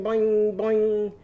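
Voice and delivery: springy toy boing; mock boing sound. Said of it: "the bat's going boing".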